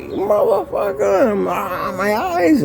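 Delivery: drawn-out high cry of pain and rage; pained yell. Retold or imitated. Inmate maced in the eyes, howling in pain. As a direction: stretch it out, high and strained. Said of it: "and I'm like".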